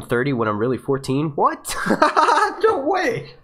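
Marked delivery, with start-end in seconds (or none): 1.3-1.7 sharp high rising what, real surprise; surprised what. Real, not acted. Reacting to a viewer's age claim. 1.8-2.6 sudden high laugh, real; high burst of laughter. Real, not acted. Laughs at the age claim. 2.6-3.3 very high, half-laughing no way; disbelieving no way. Real, not acted. Disbelief at the age claim.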